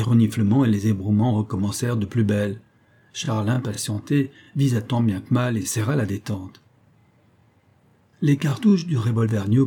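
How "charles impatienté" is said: plain reading voice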